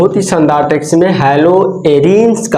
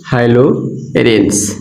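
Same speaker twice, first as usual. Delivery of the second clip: haloarenes stretched very slowly and lower; drawn out; 6 semitones lower, much slower than usual. Shown as a demonstration. Teacher to students answering her own question, slow drawn-out word. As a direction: draw out haloarenes syllable by syllable for the class. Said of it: "उसको हम लोग क्या बोलेंगे?".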